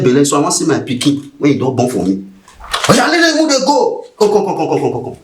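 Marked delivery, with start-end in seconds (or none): 2.9-5.2 officer shouting at his men to move; barked order. Acted. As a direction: yell the command across the road.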